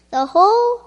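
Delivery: loud, furious storybook yell; angry scream. Acted. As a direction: bellow it like a stamping Toad. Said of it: "jumped up and down and screamed".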